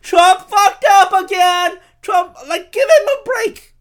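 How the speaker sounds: high, hammed-up mom voice. Mocking the media as his mom, high pitched mom voice. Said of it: "The media is like my mom".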